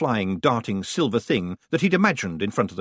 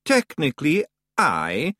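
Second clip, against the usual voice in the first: reluctant; 4 semitones higher, slower than usual. Acted. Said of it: "said Rob Anybody reluctantly".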